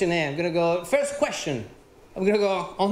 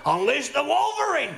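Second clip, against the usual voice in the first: triumphant cry; 4 semitones higher than usual. Pretend. Finding the chicken heart lady, a higher hammed-up triumphant cry. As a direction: announce it like a superhero.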